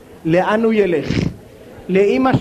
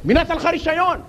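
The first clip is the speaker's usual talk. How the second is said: raised pitch, fast angry tirade; road rage rant; 6 semitones higher than usual. Retold or imitated. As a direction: tell off the other driver, fast and high. Driver yelling at other driver.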